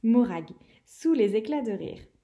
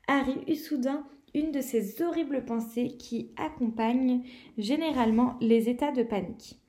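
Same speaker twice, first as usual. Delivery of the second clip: ordinary delivery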